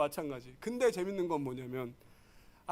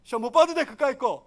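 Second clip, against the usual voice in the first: breezy parent; 5 semitones higher, 6 dB louder than usual. Retold or imitated. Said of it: "엄마도 못 봤어요".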